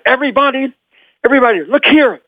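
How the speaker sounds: high, proud little introduction; excited boy announcer. Retold or imitated. Boy introducing Mister Lugosi to impress his mom, excited announcing. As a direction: announce the guest grandly, pitched well up. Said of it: "I want to impress my mom".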